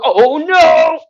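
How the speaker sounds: high, loud "oh no" as if toppling backwards; mock panic. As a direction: squeal it like you're losing balance. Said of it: "all of a sudden you go".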